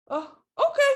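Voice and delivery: put-on high, loud little-girl agreement; child's voice. Mother imitating her daughter agreeing to clean her room, high and bright. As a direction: chirp it like a kid giving in.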